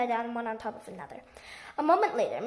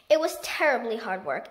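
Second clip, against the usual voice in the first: ordinary delivery, nothing marked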